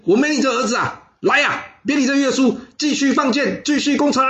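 high-pitched furious scolding; very angry. Acted. Besieging general rebuking his son on the wall, very angry. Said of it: "他非常生气的说".

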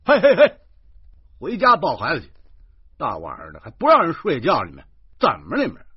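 loud high yell from upstairs; irate neighbour. A narrator's reading. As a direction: bawl down at the noisy couple. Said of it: "有个老哥探出头来说".

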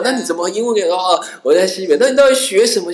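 mock questioning stranger. Stranger quizzes the traveller, lightly mocked prying tone. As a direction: play the nosy stranger quizzing him, lightly.

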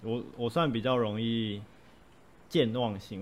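ordinary talk, nothing marked

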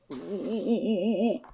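soft sulky cat grumble; mumbling. A narrator's reading. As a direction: whimper under the breath. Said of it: "布鲁斯一边咕哝". (布鲁斯委屈地咕哝)

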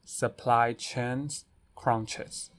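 slow careful pronunciation; slow clear repeat. Shown as a demonstration. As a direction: say each word slowly and clearly. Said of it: "你就可以讲supply chain".

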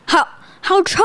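high, whining at a stink; mock wail of disgust; disgusted whine. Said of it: "再用一次就说". (闻到臭外套，带哭腔地嫌弃)

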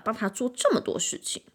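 plain reading voice

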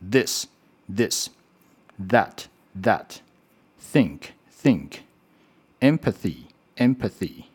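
"this, that, think, empathy", each word twice, slow and separated, with careful th; very slow "this, that, think, empathy", tongue between teeth on each th; TH review drill. Shown as a demonstration. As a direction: read like a drill, leaving room to repeat "this, that, think, empathy". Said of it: "复习一下刚刚T H的声音".

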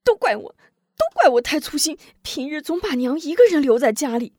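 distraught self-blame. A narrator's reading. Wu Zhong blaming himself for leaving mother alone, high anguished. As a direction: beat yourself up over it. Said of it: "急得捶胸顿足".

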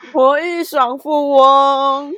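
slow, loud, drawn-out announcement; dramatic slow reveal. Acted. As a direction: drag out the name for effect. Dramatic reveal of donor name.

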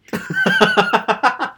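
shrill burst of laughter; high laugh. Real, not acted. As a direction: squeal of laughter. Laugh burst mid story. (尖声大笑)

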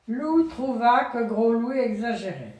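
storybook reading voice; story narration. A narrator's reading. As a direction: tell it proudly to the child.

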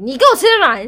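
loud scolding order, mock-angry; angry cousin. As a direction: imitate the cousin ordering her to eat. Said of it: "然后我姐超生气". (模仿表姐生气地大声命令)

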